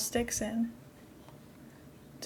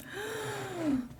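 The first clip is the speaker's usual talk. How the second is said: surprised gasp; 9 semitones higher, 6 dB quieter than usual. Real, not acted. Spots lemur babies.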